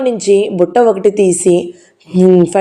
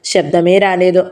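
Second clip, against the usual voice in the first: usual speaking voice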